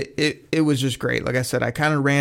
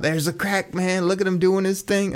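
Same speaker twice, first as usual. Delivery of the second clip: excited call, higher pitched; mock yell; 6 semitones higher than usual. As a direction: shout across the room at the dancing fan. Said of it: "They were just yelling out".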